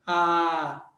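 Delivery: long A with mouth wide open. Shown as a demonstration. Teacher shows the most open vowel, held A. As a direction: open right up and hold A. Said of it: "O A é bem abertão na boca".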